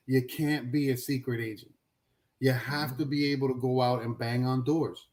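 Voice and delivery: ordinary talk, nothing marked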